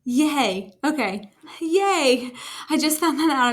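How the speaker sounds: an excited little yay; happy surprise. Real, not acted. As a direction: cheer at a sudden discovery. Delighted at finding a feature works.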